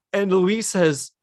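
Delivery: usual speaking voice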